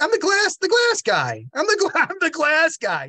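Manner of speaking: high, loud, flustered self-introduction with a laugh; nervously insisting who he is; flustered excitement. Retold or imitated.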